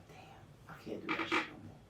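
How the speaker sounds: fast, whispered self-reproach; scolded by mother, whispering regret to himself. Retold or imitated. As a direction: whisper it quickly to yourself. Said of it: "be like".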